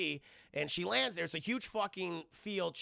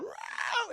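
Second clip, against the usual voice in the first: much higher shrieking cry; feral screech. Acted. Claire shrieking back at Alice's question, high feral cry. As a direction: let out a wild, crazed shriek.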